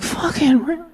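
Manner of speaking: an irritated God snapping at the nagging new arrival; annoyed God. Retold or imitated. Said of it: "And God's like".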